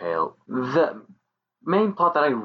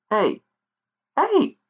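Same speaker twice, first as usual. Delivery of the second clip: high, calling fairy voice; shrill pixie calling out. Acted. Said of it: "said the pixie".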